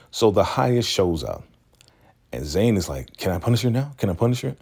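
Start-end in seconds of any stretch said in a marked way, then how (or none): 3.2-4.6 high, eager mock question; eager begging. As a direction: pester for permission, voice lifting each time. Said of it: "She is so eager to punish".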